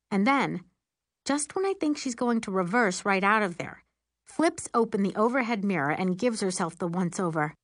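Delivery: ordinary delivery, nothing marked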